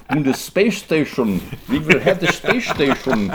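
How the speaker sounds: a hint of a German rocket scientist proclaiming; mild mock German accent announcing the future. Imitating von Braun on space.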